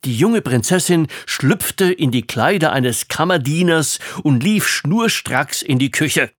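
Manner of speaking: plain reading voice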